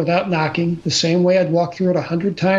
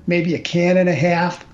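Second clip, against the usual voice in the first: ordinary talk, nothing marked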